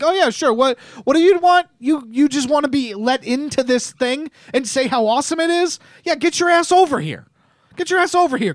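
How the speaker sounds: high, swaggering mock boss voice; a greedy executive waving people in; cocky Disney exec. Host imitating a Disney CEO, cocky and high-pitched. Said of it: "that's what I imagine the CEOs at Disney".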